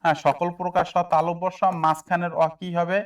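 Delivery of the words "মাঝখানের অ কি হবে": ordinary talk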